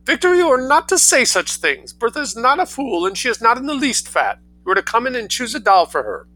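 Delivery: high, loud, hurried bossy mother; penetrating mother. Acted. Mother ordering her son about, high and penetrating. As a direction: lecture the boy in a high, penetrating rush. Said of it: "a penetrating and rather hurried manner of talking".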